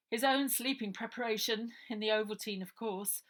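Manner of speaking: matter-of-fact explaining; Harry explaining how Uncle Arthur was drugged. Acted.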